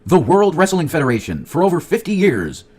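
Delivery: lightly hammed-up ring announcer; mock announcer. Imitating old announcer intro.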